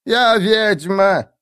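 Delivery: very slow, high proud announcement; drawn-out old witch declaring herself. Acted.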